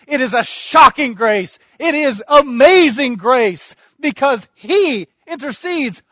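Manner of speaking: loud, building preaching, punching each display line; fervent preaching. Acted. Preacher exulting to the church over the cross, loud and building. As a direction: drive it to a climax.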